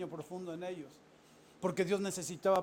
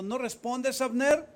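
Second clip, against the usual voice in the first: loud, high call across the valley; loud shouted challenge; 6 semitones higher, 8 dB louder, slower than usual. Acted. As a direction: shout his name across the distance. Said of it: "dio voces David".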